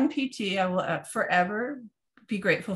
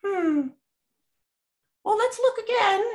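mocking medical people; 11 semitones higher than usual. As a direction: mimic them dawdling in a high put-on voice. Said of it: "The medical people were like".

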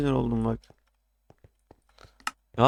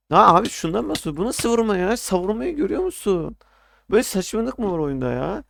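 higher and louder furious complaint; angry yell; 6 semitones higher, 4 dB louder than usual. Real, not acted. Player yelling at the screen about an unfair hit, angry and loud. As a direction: shout your outrage at the cheap hit.

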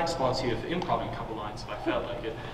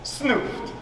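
put-on French accent; 9 semitones higher than usual. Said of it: "as they say in France".

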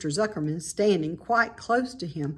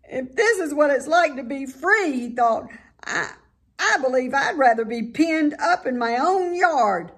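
frightened piglet; 7 semitones higher, 4 dB louder than usual. A narrator's reading. Wilbur panicking.

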